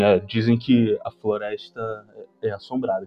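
imitating a gloomy old man warning; grim old man. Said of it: "com um olhar muito fechado".

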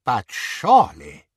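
plain reading voice

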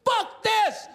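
high-pitched cursing tantrum; hammed-up snapping out. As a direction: rant in an upset, high, strained yell. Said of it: "I was snapping out".

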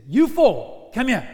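loud officer's command; barked order. Acted. Longinus ordering four soldiers over, loud command. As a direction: call them over like a commander.

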